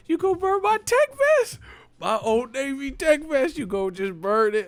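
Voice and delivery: very high, incredulous mock squeal; hammed-up high-pitched disbelief; mock outrage. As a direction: protest in a comic falsetto. Acts out outrage over burnt clothes.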